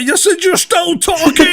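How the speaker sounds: high, loud, drawn-out mock outburst; retold exasperated yell. Owner yelling as phone pipes up again, mock exasperated yell.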